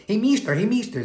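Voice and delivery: put-on high little girl with a Spanish accent calling mister; a bright Spanish-accented kid calling out, high. Little Honduran girl calls her teacher, high Spanish accent. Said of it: "with that little accent".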